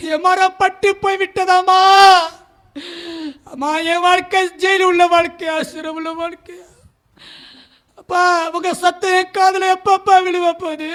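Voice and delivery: loud, pleading cry to his mother and father; anguished lament. Retold or imitated. Son cries out to his parents, loud and anguished. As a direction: wail out a son's despair.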